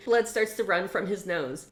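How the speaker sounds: emotional read-aloud of a novel scene; dramatic story reading. Acted. Reviewer reads the book's climax aloud dramatically.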